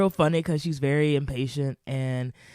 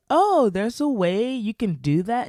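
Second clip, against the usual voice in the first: impressed friend; 8 semitones higher, 6 dB louder, slower than usual. Retold or imitated.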